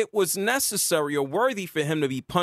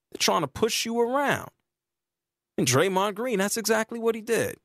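ordinary delivery

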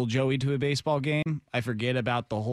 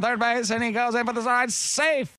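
put-on old-time radio baseball voice; 9 semitones higher than usual. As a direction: call the slide like a fifties radio man. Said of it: "old-time radio baseball voice".

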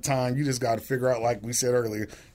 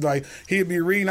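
normal talking voice

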